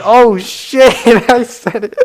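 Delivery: high, loud mock alarm; mock panicked oh shit. Coworker realizing his text went to his girlfriend, loud high mock panic. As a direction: blurt it like you just sent the wrong text.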